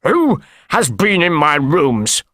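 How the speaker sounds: slow, alarmed demand in character; frantic cry. A narrator's reading. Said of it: "almost crazy with fear".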